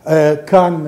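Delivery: ordinary talk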